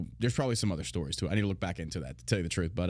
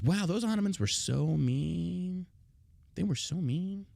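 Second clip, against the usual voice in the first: slow, higher, sarcastic pity; drawn-out sarcastic whine; mock sympathy; 5 semitones higher, slower than usual. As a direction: pretend to feel sorry for them. Mocking the history text.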